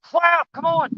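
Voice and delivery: straining, breathless, high and excited; struggling to reel in a heavy fish; fighting a fish. Real, not acted. Struggling with fish near bank.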